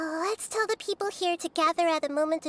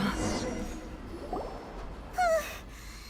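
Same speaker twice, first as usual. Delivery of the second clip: long breathy relieved sigh; sigh of relief; 13 semitones higher, 5 dB quieter than usual. Acted. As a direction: let the tension go. Relief after the battle.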